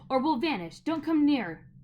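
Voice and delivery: loud firm warning; crying out. A narrator's reading. Boy warning the chasing witch, loud and firm. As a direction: hold her off with your voice. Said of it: "Diggory cried".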